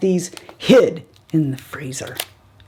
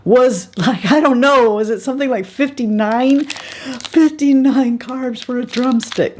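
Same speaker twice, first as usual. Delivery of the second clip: shocked; 7 semitones higher than usual. Real, not acted. Shocked at the carb count.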